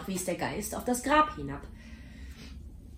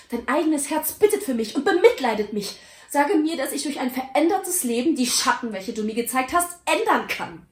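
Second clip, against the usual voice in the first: higher and louder, fervent begging; earnest plea; 6 semitones higher, 6 dB louder than usual. Acted. Scrooge begging the Ghost to let him change, fervent. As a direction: press the Ghost with an urgent plea.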